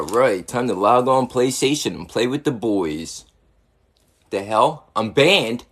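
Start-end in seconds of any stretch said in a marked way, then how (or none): none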